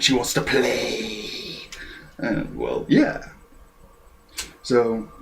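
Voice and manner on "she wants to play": slow, stretched-out mock announcement; drawn-out taunt. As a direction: draw the last word out teasingly. Acted quote of rival's taunt.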